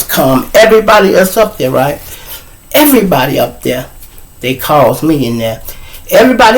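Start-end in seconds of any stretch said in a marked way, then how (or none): none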